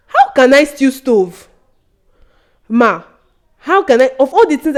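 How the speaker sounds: loud, high indignant protest; talking back. Retold or imitated. Student talking back to teacher who called her thief, indignant. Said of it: "when I talked back at her".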